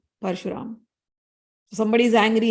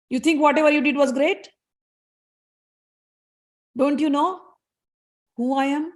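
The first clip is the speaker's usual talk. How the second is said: mild anger; 4 semitones higher than usual. Acted. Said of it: "he went on speaking all this thing in anger".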